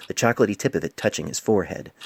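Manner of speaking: usual speaking voice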